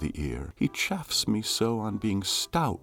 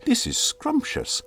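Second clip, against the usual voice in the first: much higher, delighted little squeal of a word; gushing delight. Acted. Smitten suitor gushing over his sweetheart, high and delighted. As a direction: gush it like a smitten dandy.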